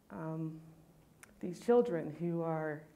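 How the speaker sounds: ordinary talk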